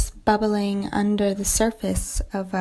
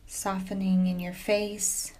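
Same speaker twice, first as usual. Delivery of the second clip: soft yoga nidra voice with long pauses; guided meditation; 6 dB quieter than usual. Acted. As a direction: gently settle the listener into stillness, speaking softly.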